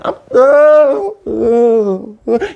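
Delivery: high wailing fake sobs; exaggerated boo-hoo wail; mock weeping. Jilted partner boo-hooing to sad songs, mock weeping. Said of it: "I'm supposed to be weeping".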